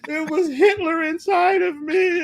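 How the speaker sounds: high, sobbing, wailing apology; mock crying apology. The heckler returns to Doctor B, hammed-up sobbing. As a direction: wail it out like a broken man in tears.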